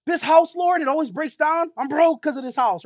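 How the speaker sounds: whiny complaint. Pretend. Preacher mimics a churchgoer grumbling to God about the house, mock whiny. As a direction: whine at God like a spoiled homeowner.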